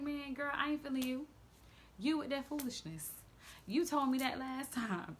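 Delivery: God as your homegirl calling out foolishness; God talking like a sassy friend. Retold or imitated. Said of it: "that's how I hear God talk to me".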